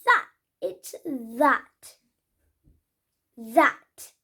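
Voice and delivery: careful "that" with a clear th; model pronunciation. Shown as a demonstration. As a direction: show the "that" sound slowly. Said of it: "the next one".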